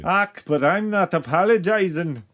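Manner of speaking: bad Welsh accent. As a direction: bark it out in a hammed-up Welsh accent. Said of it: "it's a Welsh accent by way of Mister Scott".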